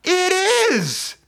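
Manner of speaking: very high, drawn-out reveal; hammed-up amazement. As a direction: play up the shock for laughs. Dramatic reveal of the baby.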